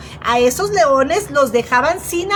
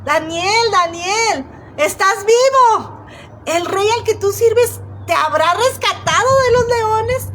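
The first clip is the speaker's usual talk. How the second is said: shouting; 7 semitones higher than usual. Acted. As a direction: call out desperately, stretching estás vivo slowly. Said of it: "le grita".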